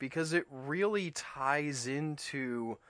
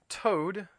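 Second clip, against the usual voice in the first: usual speaking voice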